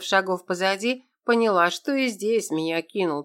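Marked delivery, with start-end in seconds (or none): none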